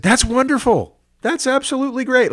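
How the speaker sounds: father bursting with delight at the news. Retold or imitated. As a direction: play the overjoyed dad on the phone. Father voiced in retold phone call.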